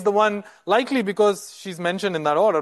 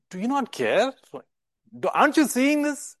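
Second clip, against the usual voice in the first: rising, indignant rhetorical question, pitched up; 8 semitones higher than usual. Shown as a demonstration. Martha challenging Jesus, rising and indignant. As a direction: ask it as an exasperated challenge. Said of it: "It's a rhetorical question".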